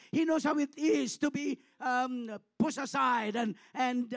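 loud, ringing sermon retelling of the tomb scene; full-force preaching. Acted. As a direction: preach it out to the whole church. Building preaching on Jesus' suffering.

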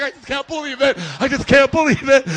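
loud, overwhelmed mock sobbing; mock crying. Preacher mimics Ricky overcome by a star, mock crying. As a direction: imitate a fan crying in disbelief. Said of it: "He's, like, crying".

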